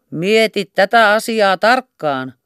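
slow, high, loud call after her; warning shout. Acted. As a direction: shout a warning across the street. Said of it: "huusi kapteeni hänelle silloin".

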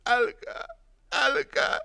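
high, thin pleading squeal; pleading whimper. A narrator's reading. Burning man begging, whimpering. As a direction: whimper and beg for mercy. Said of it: "lopulta muuttui rukoilevaksi vikinäksi".

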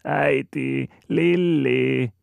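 put-on high, loud tearful cry of a small child; little girl bursting into a crying shout for mother. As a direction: burst into a wailing call. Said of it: "pillahtaa hän itkevään huutoon".

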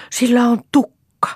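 high, loud cry of discovery; little girl calling out in excitement; child's shout. A narrator's reading. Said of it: "huusi Lea".

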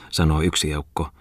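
ordinary delivery, nothing marked

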